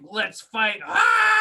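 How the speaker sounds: building to a high, loud yell; fight-ready defiance that ends in a scream; imitating the doomed heroine's furious last cry; angry battle shout. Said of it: "like an angry".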